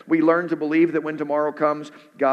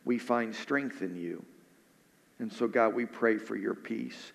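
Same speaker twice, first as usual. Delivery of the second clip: soft, lower, earnest pastor's prayer; closing prayer; 3 semitones lower, 4 dB quieter than usual. Acted. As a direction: pray quietly over the congregation, fading at the end.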